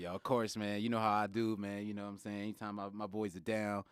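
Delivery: low, soft laid-back bro voice; smooth chill dude offering help; frat bro. Pretend. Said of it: "Bro, be Jawan".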